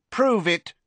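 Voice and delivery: sharp, high-pitched order; imperious young Riddle's command. A narrator's reading. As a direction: demand it at once. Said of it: "in the same commanding tone".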